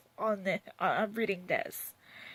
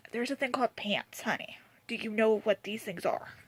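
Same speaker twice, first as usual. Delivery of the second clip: sassy whisper. Retold or imitated. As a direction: whisper it, condescending.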